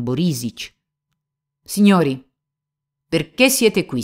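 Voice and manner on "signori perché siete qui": bewildered call. Acted. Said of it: "chiese Mitya".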